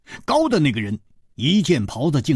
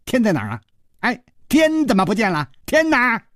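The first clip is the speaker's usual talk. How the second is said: high mock wonder, climbing to an outcry; playful dwarf; 11 semitones higher, 3 dB louder than usual. A narrator's reading. Dwarf under his huge hat, playfully crying out. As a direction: call out in teasing dismay. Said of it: "笑嘻嘻地说".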